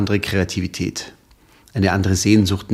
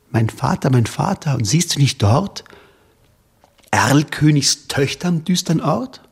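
higher, rising panic; frightened child; 4 semitones higher than usual. Acted. Child begs father to hear the Erlking, higher. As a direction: cry out to father, growing fear.

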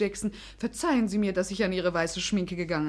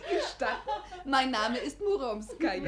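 mock Muromski; 3 semitones higher, 4 dB quieter than usual. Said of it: "aber das ist zum Totlachen".

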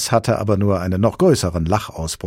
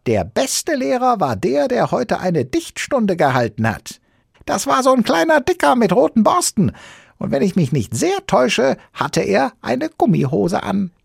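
high, louder creature voice; Sams explaining; 6 dB louder than usual. Acted. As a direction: explain eagerly in a squeaky voice.

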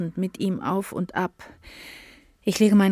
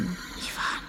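a whispered name, almost no voice; a faint whispered name; breathed whisper; 10 dB quieter than usual. Acted. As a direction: breathe the name out as she drifts off. Falling into semi-consciousness.

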